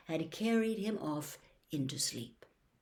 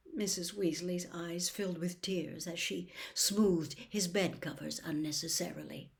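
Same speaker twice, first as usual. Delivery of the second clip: ordinary delivery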